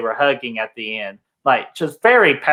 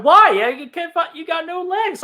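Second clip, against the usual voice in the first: mock Rambo breakdown. Friends parodying Rambo's speech to Troutman, whiny mock voice. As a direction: parody the soldier's meltdown, squeaky and small.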